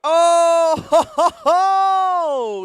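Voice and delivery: high, loud laughing whoop; laughing cheer. Real, not acted. Commentator cheering a trick.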